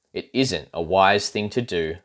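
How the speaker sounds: usual speaking voice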